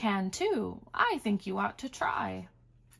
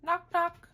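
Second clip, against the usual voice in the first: higher, rapping knocks; door knock; 9 semitones higher than usual. Acted. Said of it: "He can sound like a hand on a door".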